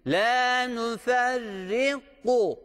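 slow tajweed recitation of "La nuferriku" with a guttural q; correct Quranic recitation. Shown as a demonstration. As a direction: chant "La nuferriku" slowly, drawing out each vowel. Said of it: "Bunu G harfi gibi okumayacağız".

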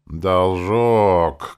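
hoarse nasal mocking twang; nasal and hoarse, mimicking someone; stuffy nose. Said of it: "хрипатым голосом прогнусавила".

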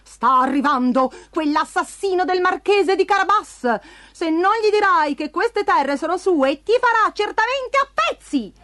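threatening cat. A narrator's reading. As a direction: warn him, building in pitch toward the end. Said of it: "sibilò il gatto con gli stivali".

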